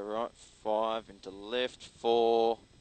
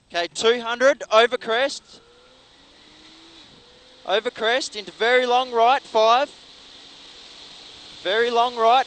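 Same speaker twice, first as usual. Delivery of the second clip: loud, urgent co-driver calls; rally pace notes; 12 semitones higher, 13 dB louder than usual. Real, not acted. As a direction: bark out corner calls over the engine.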